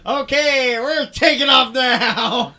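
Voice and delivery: high, loud, laughing takeoff cry; excited shout. Acted. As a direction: yell it out, thrilled to launch.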